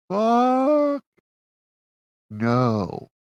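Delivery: high, drawn-out yell then emphatic no; indignant shout. Real, not acted. Reviewer answering own question about earning the suit, indignant shout. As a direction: belt out the swear word, stretched high.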